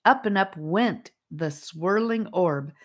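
usual speaking voice

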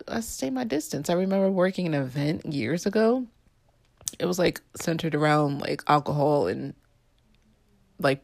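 normal talking voice

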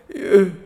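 imitated laugh muffled behind a mask; muffled laugh like a plea for help. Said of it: "it's kind of a muffled mouth".

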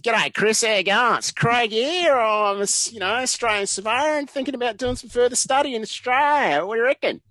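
high, loud, hammed-up Aussie accent; broad Australian accent. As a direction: lay on your best Australian accent. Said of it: "put on my best Australian accent".

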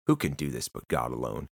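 low, quiet grumbling question; jealous Pharisees muttering low and soft; muttered objection. Acted. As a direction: mutter it under your breath like a jealous critic.

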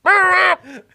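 loud, high garbled squawk; squawking cartoon-duck noise; Donald Duck squawk. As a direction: imitate an unintelligible yell from far below. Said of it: "he sound like Donald Duck".

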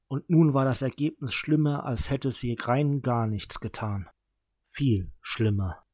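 ordinary delivery

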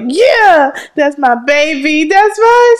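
high, laughing proud cheer; proud mama. Retold or imitated. Mother cheering for her baby's first word. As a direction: gush over the baby with pride. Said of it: "I was like".

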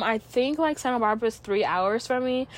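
usual speaking voice

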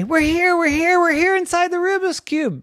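high, urgent little voices; desperate kids calling. Acted. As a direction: cry out to be found. Trapped kids answer the call.

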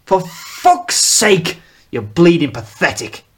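disgusted rebuke. Acted. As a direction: spit out the contempt. Father berating sons.

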